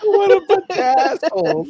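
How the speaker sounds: high, loud laughing exclaim; laughing outburst. Real, not acted. Player cracking up at the rude party, laughing loud and high. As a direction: crack up while you say it.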